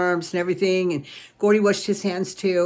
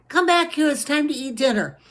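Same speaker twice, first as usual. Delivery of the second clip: higher, louder mom calling; calling child; 8 semitones higher, 4 dB louder than usual. A narrator's reading. Mom calls her flying son back for dinner, higher louder call. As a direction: call up the stairs after him.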